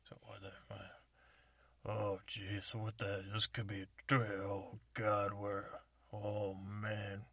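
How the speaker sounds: ordinary delivery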